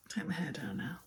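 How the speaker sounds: soft whispered aside; whispering. Real, not acted. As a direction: murmur it under your breath. Aside while fixing hair.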